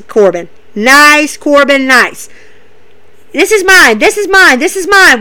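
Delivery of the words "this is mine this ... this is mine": higher, louder possessive shout; loud claim. Retold or imitated. Corbin claiming the crown, loud repeated shout. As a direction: bellow ownership of the crown. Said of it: "Baron Corbin's standing there holding the crown".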